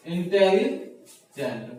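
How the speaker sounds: Intelligent stretched out slowly, syllable by syllable; slow intelligent right down for the students; intelligent broken into its four parts, slowly. Shown as a demonstration. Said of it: "इसको बोलने के लिए क्या करते हैं".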